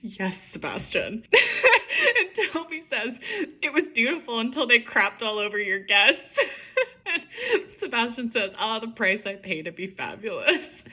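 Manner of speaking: giggly read-through, fighting back laughter; can't keep a straight face; laughing while reading. Real, not acted. Author reading her own funny scene aloud, laughing. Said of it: "This gets me every time".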